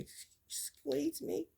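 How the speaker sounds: slow, high, prim little excuse me; dainty excuse me. Pretend. Excusing herself after passing gas, high and prim. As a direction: say it like a prissy lady. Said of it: "I would say".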